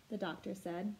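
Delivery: ordinary delivery, nothing marked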